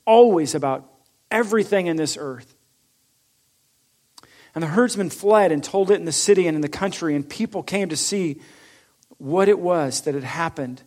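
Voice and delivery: normal talking voice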